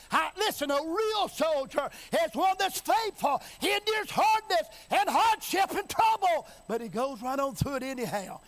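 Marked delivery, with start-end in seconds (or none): 0.1-6.5 raised, forceful preaching building in pitch. Real, not acted. Preacher exhorting the congregation about a real soldier, forceful preaching. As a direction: preach it harder, voice climbing.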